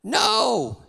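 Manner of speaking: father screams a refusal at his son; screamed no. Retold or imitated. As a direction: cut him off with a yelled no. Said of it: "screamed the dad".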